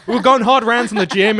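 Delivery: mocking Chloe. Host mocks friend's bragging about childhood MMA, high and loud. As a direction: puff up like a proud fighter. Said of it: "to be like".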